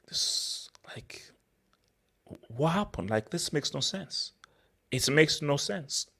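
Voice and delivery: hushed, high, slowed-down bewilderment; retold stunned disbelief. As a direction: start almost voiceless, then softly replay the confusion, drawn out. Retelling stunned reaction to news.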